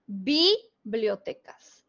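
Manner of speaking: "bibliotecas" slow, first syllable BI stressed. Shown as a demonstration. Teacher imagining a wrong stress for students, stress on bi. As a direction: lean on bi in "bibliotecas", then the rest. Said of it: "la sílaba tónica de bibliotecas es bi".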